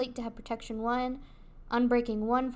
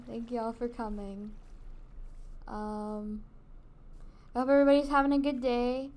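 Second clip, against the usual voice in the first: ordinary delivery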